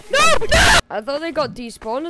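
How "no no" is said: panicked no. Real, not acted. Panicked horror game.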